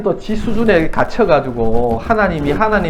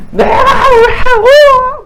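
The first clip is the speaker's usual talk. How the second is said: high, loud, drawn-out tearful shout; drunk whining yell; 7 dB louder, much slower than usual. Retold or imitated. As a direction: wail it like an angry drunk on the phone. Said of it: "막 신경질 내요". (술 취해 신경질 내며 울부짖듯)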